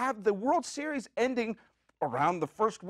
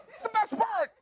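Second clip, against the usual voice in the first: exasperated insistence; 13 semitones higher than usual. Real, not acted.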